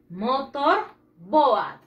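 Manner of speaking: motor boat said slowly and clearly; slow, careful pronunciation model; slow model word. Shown as a demonstration. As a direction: stretch "Motor boat" so the class can copy it. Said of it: "Repeat after me".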